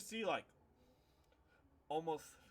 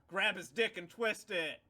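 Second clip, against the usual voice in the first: crowd heckle; 5 semitones higher, 8 dB louder than usual. Retold or imitated. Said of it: "people are yelling".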